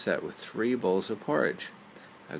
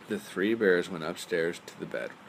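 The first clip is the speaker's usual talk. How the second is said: plain reading voice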